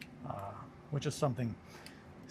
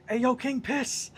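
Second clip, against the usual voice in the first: high, slow call from far across the street; distant call-out; 13 semitones higher, 7 dB louder, slower than usual. Retold or imitated. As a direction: holler it like a stranger across the road. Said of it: "someone off in the distance goes".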